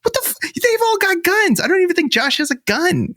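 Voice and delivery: high-pitched, hammed-up disbelief; incredulous outrage. Objecting to sending Josh alone, incredulous mock outrage. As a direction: squeal in comic indignation at the plan.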